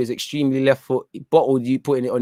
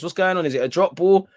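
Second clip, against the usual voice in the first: ordinary talk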